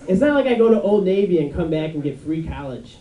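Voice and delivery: ordinary delivery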